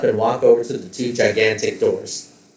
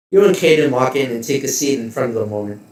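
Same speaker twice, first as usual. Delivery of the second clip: ordinary talk, nothing marked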